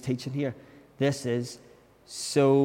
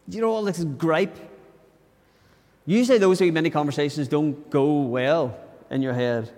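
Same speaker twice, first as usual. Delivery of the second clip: ordinary talk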